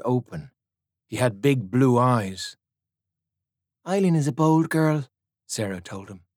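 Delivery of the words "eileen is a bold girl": put-on lightly higher girlish voice; small girl voice. A narrator's reading. Girl to the soldier, prim and higher. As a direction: answer like a prim little girl.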